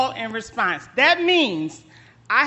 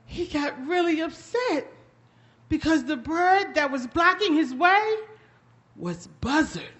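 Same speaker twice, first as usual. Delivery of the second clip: usual speaking voice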